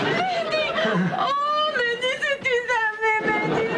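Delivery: tearful wail. Acted. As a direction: wail to your son through tears. Weeping mother greets her son.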